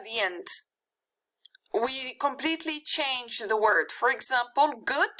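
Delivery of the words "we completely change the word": normal talking voice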